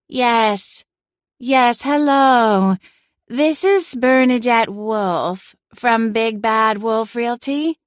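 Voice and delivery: a cat playing a languid real estate agent; airy, sedated realtor voice. A narrator's reading. Celine fakes an airy realtor voice to the homeowner on speakerphone. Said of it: "her voice coming out in some airy, almost sedated voice".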